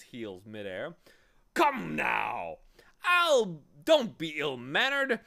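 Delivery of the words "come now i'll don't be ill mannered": loud, high, hectoring voice; blustering humbug. A narrator's reading. Humbug demanding introduction to the boy, loud bluster. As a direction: scold them grandly at full volume.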